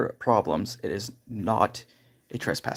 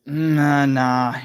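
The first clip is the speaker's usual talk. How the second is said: drawn-out nah, raised and loud; mock reluctant refusal; 3 semitones higher, 6 dB louder than usual. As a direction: imitate a cop balking, higher and louder. Acted cop refusing to take phone.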